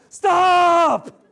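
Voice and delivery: very high, very loud yell; acted fed-up outburst, drawn out at full volume; exasperated shout. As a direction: cut off the whining with a big shout. Acted fed-up cry at whining.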